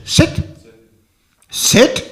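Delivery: loud, high command to sit; barked order. Retold or imitated. Rajasthani lady ordering the monk to sit, loud and harsh. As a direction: order him down like a drill sergeant.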